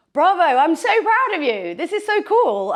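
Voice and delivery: high, loud proud cheering; excited praise. Real, not acted. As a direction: beam with pride out loud.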